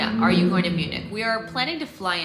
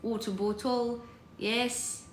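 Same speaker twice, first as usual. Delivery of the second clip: higher, softer Cockney; Cockney accent; 3 semitones higher, 7 dB quieter than usual. Shown as a demonstration. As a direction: try it softly in Cockney. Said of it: "Cockney accent".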